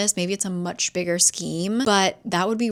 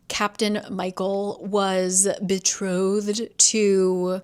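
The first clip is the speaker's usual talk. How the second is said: ordinary talk, nothing marked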